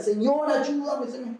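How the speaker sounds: high, slow pleading to the Lord; intense prayer. Shown as a demonstration. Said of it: "orando con intensidad". (súplica intensa, aguda y lenta)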